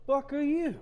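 sudden loud hostile bark; guard shouts at a stranger on the ship; angry guard challenge. Acted. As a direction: catch an intruder red-handed. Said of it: "he looks up and he goes".

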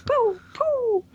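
high imitated gun noises; mouth gunshots. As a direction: poo poo gunshot sounds. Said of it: "they're like".

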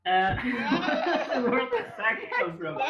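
laughing through the words; giggling as he speaks. Real, not acted. Still laughing at the pun.

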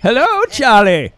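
high, loud, slow Maine greeting; drawn-out Maine fisherman holler; Maine accent. Acted. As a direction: hail a pal in an exaggerated Maine accent. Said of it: "Do your Trump from Maine".

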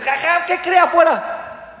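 put-on high, slow child's voice; a panicky little kid calling out; excited child. Pupil bursting in on the teacher, high excited child voice.

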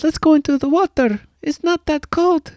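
put-on a sinister child luring his parents into icy water; creepy kid voice. Comedian voicing the evil kid, high and eerie. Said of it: "the kid says".

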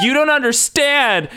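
put-on high, drawn-out teen-style whine; hammed-up wail of being misunderstood; mock melodramatic protest. Acts Bobby's dramatic protest.

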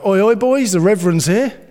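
loud, high mock shout of a laddish mate; a friend ribbing the vicar in a loud jeering call; mate teasing. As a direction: call out across the room like a cheeky mate. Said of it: "sometimes he'd go".

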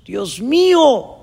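high, loud, drawn-out plea; acted shout, stretching each plea; desperate cry. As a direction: cry out to God from the mountainside. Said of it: "En eso le grita a Dios".

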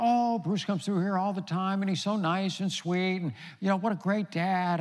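high, gushing imitation of fans; gushing admirer. Gushing airport staff imitated.

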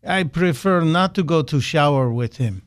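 bad Russian accent; hammed-up, deliberate Russian-accented reply; Vladimir Putin voice. Host quoting Putin's answer about the shower, bad Russian accent. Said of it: "again in my bad Putin voice".

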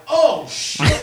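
loud shocked "oh shit" with a laugh; shocked at himself, laughing it out. Retold or imitated. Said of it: "And I'm like".

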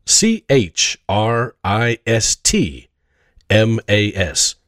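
slow letter by letter spelling; spelled out slowly. Shown as a demonstration. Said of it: "today's magic word is".

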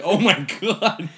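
high, loud, slow appalled groan; mock disgust. Player watching the toilet grab, slow appalled mock cry. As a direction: drag it out appalled.

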